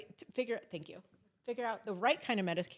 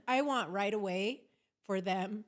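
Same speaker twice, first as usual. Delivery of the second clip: ordinary delivery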